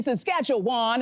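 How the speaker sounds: slow "Saskatchewan" with a full WAHN ending; outsider's pronunciation. Retold or imitated. Anchor re-enacting her on-air slip, "Saskatchewan" ending in WAHN. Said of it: "you don't say Saskatchewan".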